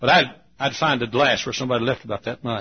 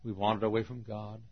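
ordinary talk, nothing marked

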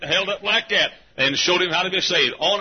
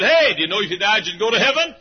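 raised, higher questioning voice; retold eager call to someone nearby; 5 semitones higher, 5 dB louder than usual. Preacher asks the maid about heaven, calling out.

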